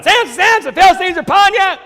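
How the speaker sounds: high, loud mock yell. Delilah yells to the bound Samson, high and loud. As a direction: shout the alarm up high. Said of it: "and yells out".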